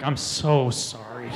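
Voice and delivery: slow, low mock apology; grave mock apology. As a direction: apologize slowly and low, dreading what comes. Apologizing to teens before possibly vomiting.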